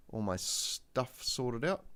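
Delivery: ordinary talk, nothing marked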